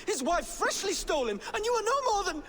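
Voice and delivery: rising, voice breaking with tears and anger; tearful angry accusation. Acted. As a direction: hurl it at her, near tears. Son accuses mother in quarrel.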